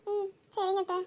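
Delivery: teary, soft reply, still crying; tearful. Acted. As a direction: answer through tears. Crying niece answers aunt. (அழுகையுடன் மெல்லிய பதில்)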